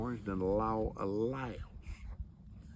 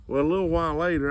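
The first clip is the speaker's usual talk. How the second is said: ordinary talk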